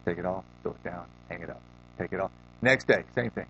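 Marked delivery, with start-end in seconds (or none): 0.1-2.3 low, quiet, clipped command from a stern teacher; stern master. Retold or imitated. Kung fu master ordering Dre, low and stern. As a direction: give the order low and unmoved. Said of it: "He says".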